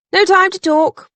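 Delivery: high, loud call. A narrator's reading. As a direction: toss it out loudly as you head off. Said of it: "she called".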